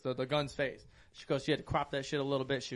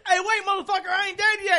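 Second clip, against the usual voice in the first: high, loud cartoonish protest; high laughing dying NPC; 9 dB louder than usual. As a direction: squeak it out, half dead. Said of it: "he goes".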